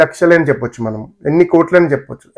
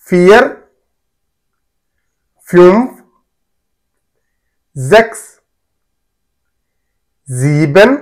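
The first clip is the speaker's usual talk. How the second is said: one word at a time, long pauses; careful, emphatic counting for students to echo; repeat-after-me drill; 4 dB louder, much slower than usual. Shown as a demonstration. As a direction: model each German number firmly for learners. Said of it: "repeat after me".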